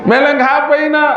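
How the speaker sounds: high-pitched mock child's whine; anak merengek. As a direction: beg mother like a whining child. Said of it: "Apa pemilik nangis wah?". (rengekan anak meminta dengan nada tinggi)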